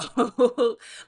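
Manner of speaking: loud laugh. Real, not acted. Laughing at her own joke.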